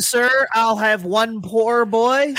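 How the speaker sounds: slow, drawn-out mock Southern order; lightly put-on Southern drawl ordering a po' boy; mock Southern accent. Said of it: "Brian Kelly's Southern accent".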